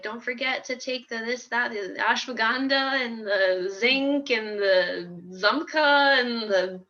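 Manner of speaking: slow, drawn-out list of odd names; mock mom reeling off every supplement slowly; a hint of nagging mother, slowed down; imitating mom listing supplements. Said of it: "she'll be like".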